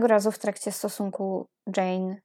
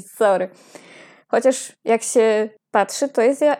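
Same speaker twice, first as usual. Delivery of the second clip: ordinary talk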